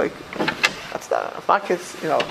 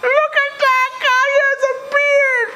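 high, loud, laughing mock of jeering inmates; squealing mockery; 4 dB louder, much slower than usual. Rabbi voicing jeering asylum inmates, high squealing laughter. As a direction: shriek it like a mocking crowd.

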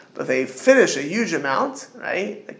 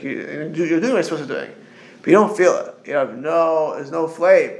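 usual speaking voice

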